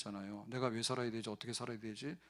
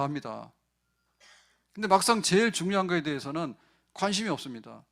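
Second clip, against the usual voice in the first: louder, driving sermon climax; preaching at full force; 3 semitones higher, 7 dB louder than usual. Acted. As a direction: hammer the point home to the congregation.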